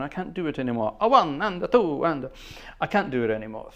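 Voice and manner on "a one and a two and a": a mild bandleader count-in, slightly raised and louder; half-hearted bandleader counting the band in. Retold or imitated. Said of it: "do a [name] impression".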